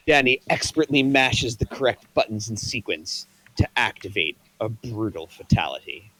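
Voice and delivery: ordinary delivery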